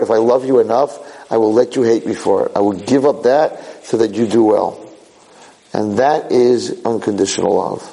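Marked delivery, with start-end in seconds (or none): none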